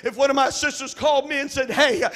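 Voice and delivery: full-force pulpit preaching; fiery preaching. Acted. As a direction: hammer the congregation with conviction.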